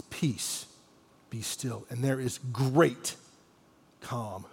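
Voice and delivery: very soft, low, slow pronouncement; hushed calm. Retold or imitated. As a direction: drop to a hush, leaving space between phrases. Jesus stilling the storm, calm falls.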